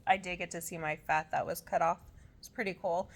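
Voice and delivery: normal talking voice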